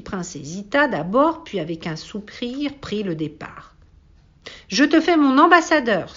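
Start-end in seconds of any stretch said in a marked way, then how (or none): 4.7-6.1 loud, high royal proclamation; hurried cry. A narrator's reading. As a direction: call it out after someone leaving, pitched up high. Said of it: "se hâta alors de crier".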